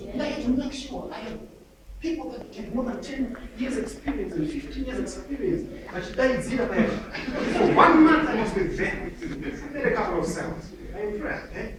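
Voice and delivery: ordinary talk, nothing marked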